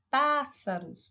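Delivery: PÁ of "pássaros" high, loud and drawn out; stressed first syllable. Shown as a demonstration. Teacher showing which syllable stands out, PÁ in "pássaros" stressed. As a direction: punch and stretch PÁ in "pássaros". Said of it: "o pá se destaca mais".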